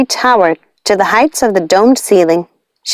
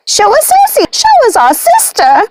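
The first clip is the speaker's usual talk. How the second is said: high, mock screaming witch voice; screaming witches. A narrator's reading. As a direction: demand their sister. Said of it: "screaming and clicking".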